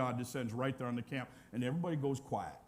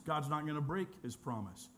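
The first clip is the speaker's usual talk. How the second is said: usual speaking voice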